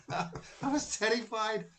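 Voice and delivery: laughing nervously, pitch up; nervous laugh while telling the scary moment. Real, not acted. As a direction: laugh through the fear you're recalling. Recalls medium picking him out.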